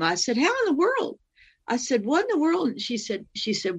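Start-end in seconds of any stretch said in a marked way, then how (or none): 0.4-1.2 high-pitched, baffled question; acting out bewildered amazement; retold astonishment. Retold shock at dead mother calling. 2.0-2.7 high, baffled exclamation; acting out bewilderment; retold astonishment. Retold shock at dead mother calling.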